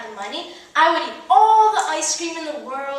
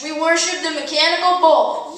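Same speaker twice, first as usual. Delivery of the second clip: ordinary delivery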